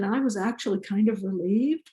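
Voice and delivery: usual speaking voice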